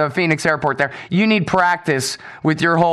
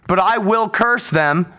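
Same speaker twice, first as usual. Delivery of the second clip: ordinary talk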